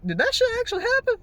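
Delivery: very high, quick incredulous squeal; mock disbelief. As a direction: imitate someone stunned by what just happened. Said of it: "he's like".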